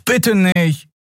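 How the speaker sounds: high, pleading whimper of a plea; imploring, pitched well up; Miko. A narrator's reading. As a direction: beg your opponent to spare you. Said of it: "flehte Miko".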